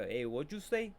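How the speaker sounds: soft mimicked question; imitating his voice. Viewer repeating the catchphrase, soft imitation. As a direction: mimic him softly. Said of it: "That's how he sounded".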